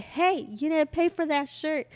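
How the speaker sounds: insistent calling out. Retold or imitated. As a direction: call out firmly to someone ignoring you. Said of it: "she tried to ignore me".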